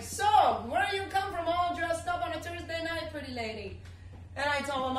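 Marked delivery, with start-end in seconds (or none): 0.0-3.8 light West Indian lilt, higher; a friendly West Indian cab driver's greeting; West Indian accent. Retold or imitated. Cab driver greeting a dressed-up passenger, light West Indian accent. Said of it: "West Indian accent".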